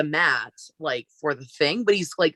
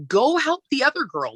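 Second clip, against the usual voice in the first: exasperated order; 10 semitones higher than usual. Retold or imitated. Telling off a clingy coworker.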